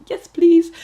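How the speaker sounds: high, loud, thrilled yes please; eager yes. Retold or imitated. To the company offering a job, a thrilled high yes. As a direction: jump at the offer.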